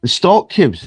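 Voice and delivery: broad Scottish "stock", open Scottish vowel; Scottish accent, repeating. Retold or imitated. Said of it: "in pure Scottish".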